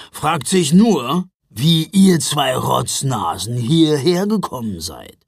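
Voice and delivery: put-on slow, gruff old man voice; gruff Ruprecht. A narrator's reading. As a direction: talk down to the kids like a grumpy old man. (mürrischer Alter, langsam und brummig)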